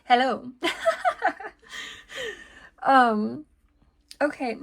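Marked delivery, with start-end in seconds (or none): 0.6-1.5 high-pitched giggle; laughing. Real, not acted. Card reader greets the expected card, high laugh.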